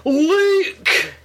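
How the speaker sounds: high, far-off Scottish shout of one word; Billy Connolly. Retold or imitated. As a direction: belt the name like the comedian. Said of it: "For Billy Connolly".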